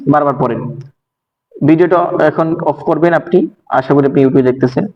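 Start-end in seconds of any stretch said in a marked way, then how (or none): none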